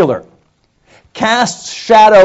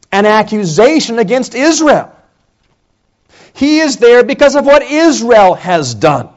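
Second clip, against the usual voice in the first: normal talking voice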